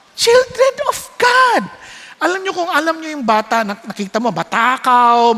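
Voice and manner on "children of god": high, slow, shouted declaration; ringing proclamation. Real, not acted. Preacher shouting the answer to the congregation, slow and ringing. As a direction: proclaim it to the back row.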